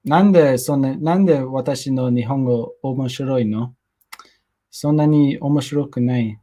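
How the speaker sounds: plain Japanese on request, answering a viewer; speaking Japanese. Shown as a demonstration. Speaking Japanese for a viewer, casual. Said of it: "speak Japanese for ten seconds".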